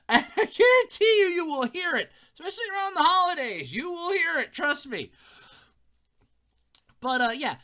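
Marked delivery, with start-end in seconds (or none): none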